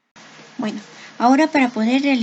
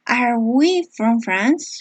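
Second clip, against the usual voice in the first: slow, careful English question; slow model question; much slower than usual. Shown as a demonstration. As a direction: read it out slowly for students. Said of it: "teacher modeling question slowly". (pregunta modelo lenta y clara)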